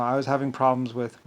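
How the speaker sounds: ordinary talk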